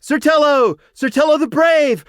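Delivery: high, loud chant of a crowd; crowd cheer. A narrator's reading. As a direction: cheer him as one voice. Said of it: "the host cheered as one".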